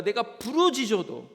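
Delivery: acted prophet's cry; crying out to God. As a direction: call out slowly and forcefully, like a pleading prophet. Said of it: "하나님께 어떻게 합니까?".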